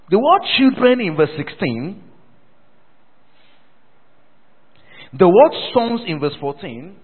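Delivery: loud, projected teaching from the pulpit; emphatic preaching. Real, not acted. Preacher pointing congregation to the verses, emphatic preaching. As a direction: announce each key word to the whole church.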